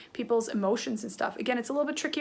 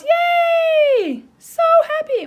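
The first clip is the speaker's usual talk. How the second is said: squealed yay, much higher and louder; excited cheer; 9 dB louder, much slower than usual. Pretend. Teacher acting out an excited girl for kids, high cheer. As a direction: burst with glee like a thrilled kid. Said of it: "And the eyebrows".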